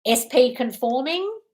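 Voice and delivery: "S P conforming" drawn out slowly, rising at the end; slow, tentative "S P... conforming?"; trying out a title. Real, not acted. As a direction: float "S P conforming" slowly as a question. Trying out second thumbnail title.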